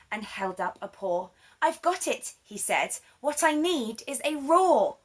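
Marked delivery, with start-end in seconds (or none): 1.6-2.3 higher, eager little mouse; a mouse hit by a bright idea; excited mouse. Acted. Mouse voiced in story. 3.2-5.0 higher, eager little mouse; a mouse hit by a bright idea; excited mouse. Acted. Mouse voiced in story.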